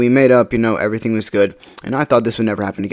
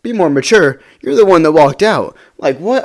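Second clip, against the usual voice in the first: higher-pitched, indignant echo of the accusation; incredulous; 5 semitones higher, 4 dB louder than usual. Retold or imitated. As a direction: throw it back in disbelief, punched louder. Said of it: "I couldn't believe what I was seeing".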